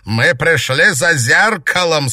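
loud, high gloating taunts; sneering panto villain. A narrator's reading. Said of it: "Бест злорадно расхохотался".

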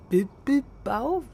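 puzzled reading. Acted. Trying to read a tiny sticker.

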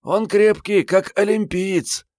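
put-on high, strained girlish croak; hoarse girl. A narrator's reading. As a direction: wheeze it out high, dragging the end. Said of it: "прохрипела дева".

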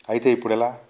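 fearful question. A narrator's reading. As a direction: ask with a touch of fear. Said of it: "Bhushanam భయంతో ప్రశ్నించాడు".

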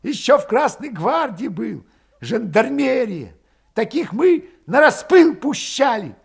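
high, loud taunt; spiteful jab. A narrator's reading. Valet taunting Grigory from the doorway, spiteful. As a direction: fling an accusation on the way out. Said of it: "кольнул улыбавшегося зло Григория".

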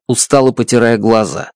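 ordinary delivery, nothing marked

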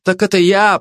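high, timid excuse; mildly flustered, high-pitched mumble; meek babble. A narrator's reading. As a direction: stammer out a weak excuse. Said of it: "пролепетал Вскипелов".

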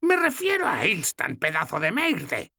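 voiced old woman, exasperated; exclaiming old goddess. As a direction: scold the fool, slowing on the insult. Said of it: "exclamó Logna".

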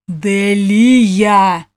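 loud, drawn-out roar; roaring name. A narrator's reading. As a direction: bellow the name from the corridor. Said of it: "протяжный рёв".

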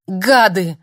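loud furious cry; angry shout. Acted. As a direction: hurl the insult. Furious woman at door.

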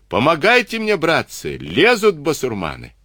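high, desperate cry for help; straining shout. Acted. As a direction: scream for help from above. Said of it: "надрывался кто-то с высоты". (отчаянный крик о помощи)

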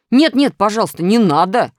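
loud whining begging; wailing plea. A narrator's reading. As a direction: wail for mercy. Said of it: "запричитал Минус". (жалобное причитание)

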